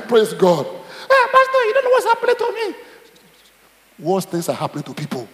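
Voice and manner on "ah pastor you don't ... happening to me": high, whiny complaint with a laugh in it; mock whining church member. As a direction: play a moaning member crying to the pastor. Pastor voices a complaining member.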